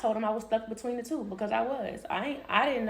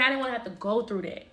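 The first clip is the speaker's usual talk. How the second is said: ordinary talk, nothing marked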